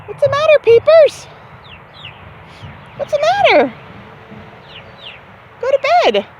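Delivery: high cooing voice for the little birds; pet talk. Real, not acted. Woman soothing peeping chicks, high cooing pet talk. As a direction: coo at them like babies.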